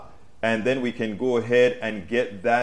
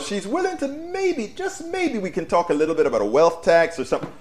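much higher, mincing mock voice on maybe, just maybe; mocking Sinema; 7 semitones higher than usual. Host mocking Sinema's hedging on a wealth tax, high sing-song.

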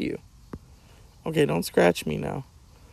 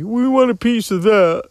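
voicing the dog; 7 semitones higher, 4 dB louder than usual. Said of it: "now the dog's hearing me".

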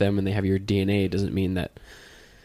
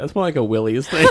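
higher, louder mimicry while laughing; imitating her; 3 semitones higher, 4 dB louder than usual. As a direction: copy her line, laughing. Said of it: "the way you said it".

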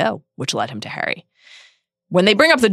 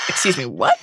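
incredulous; 5 semitones lower than usual. Retold or imitated.

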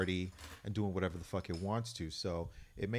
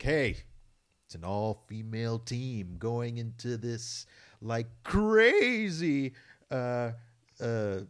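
high, slow, booming announcer; War of the Worlds broadcast drama; dramatic radio voice; 3 semitones higher, 6 dB louder, slower than usual. Acted. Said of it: "get off the radio voice".

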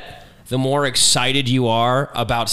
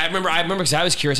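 normal talking voice

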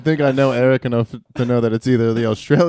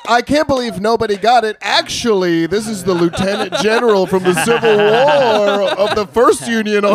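higher, louder smug lecturing voice; mock know-it-all friend; 7 semitones higher, 6 dB louder than usual. Teasing the friend who always knows, smug mock lecture. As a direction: play the friend showing off trivia. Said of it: "He'll be like".

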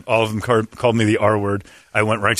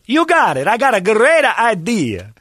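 high, slow, eager stylist voice; mock eager stylist; 10 semitones higher, 4 dB louder, slower than usual. Stylist promising a great idea, high eager mock voice. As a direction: ham up the stylist's confidence.

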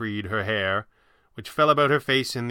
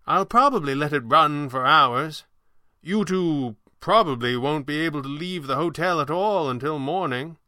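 higher, theatrical sorcerer, sly; hint slyly as the old showman; measured, high-voiced magician; 6 semitones higher than usual. Voices Falcone.

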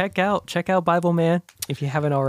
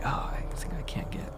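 much softer, unvoiced thought; inner-thought whisper; 9 dB quieter than usual. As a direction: breathe it as a private thought. Derek's inner thought voiced.

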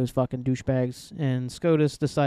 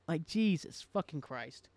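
exasperated mutter; 12 dB quieter, slower than usual. Real, not acted. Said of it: "'cause I'm pissed".

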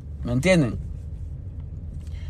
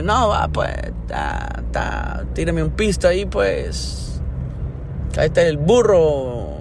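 cocky retort; slower than usual. Retold or imitated. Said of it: "Entonces dije yo".

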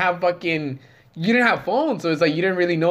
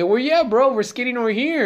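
calling out; 9 semitones higher than usual. Retold or imitated. Friend calling out to homie.

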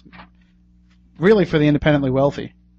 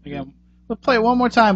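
usual speaking voice

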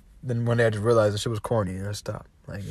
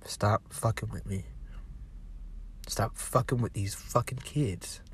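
whisper; 4 semitones lower, 13 dB quieter than usual. Real, not acted. Menacing warning to viewers.

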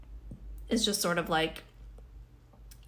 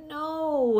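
a sudden high, loud gasped no at the cold; shocked no; 9 semitones higher, 5 dB louder than usual. Retold or imitated. Jumping into a cold pool, yelping in shock. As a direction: recoil from icy water. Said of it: "you're like".

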